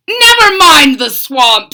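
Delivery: loud mad scientist retort. Acted. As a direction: brush him off. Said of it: "said the doctor".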